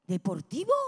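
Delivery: low scornful echo of the word; mocking disbelief. Repeating the shop's word with scorn.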